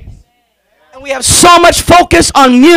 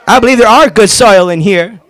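ordinary talk, nothing marked

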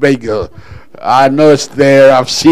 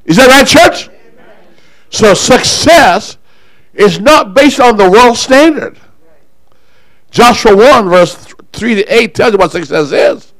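raised, higher-pitched preacher's voice; fervent preaching; 7 semitones higher, 5 dB louder than usual. Real, not acted. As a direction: preach it out to the whole church. Preaches with force to congregation.

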